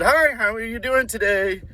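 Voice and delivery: high, upbeat customer-service greeting; chirpy counter welcome; friendly fast-food greeter. Acted. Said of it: "working at McDonald's and somebody just walked".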